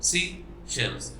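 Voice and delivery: "सी shells" in two separate beats; split into syllables. Shown as a demonstration. Teacher repeating the two-syllable word, broken into two beats. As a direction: leave a gap after सी, then shells. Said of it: "दो झटकों में अदा हो रहा है".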